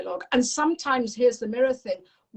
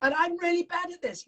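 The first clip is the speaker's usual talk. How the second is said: put-on higher child voice trailing off; demo of a child; 7 semitones higher than usual. Shown as a demonstration. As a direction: voice a discouraged kid lightly. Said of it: "The word I use is yet".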